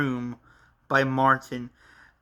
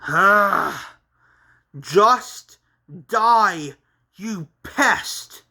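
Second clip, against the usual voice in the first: raised voice, word by word in bursts; exasperated villain; 5 semitones higher, 5 dB louder, much slower than usual. Acted. Villain Martin attacking Henry, angry and emphatic. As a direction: spit out each word with loud pauses between.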